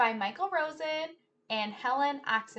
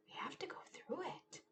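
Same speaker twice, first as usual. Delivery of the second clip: soft quick whisper; quiet determined whisper; 6 semitones higher, 15 dB quieter than usual. Acted. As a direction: hush it before the plunge. Bracing for storm.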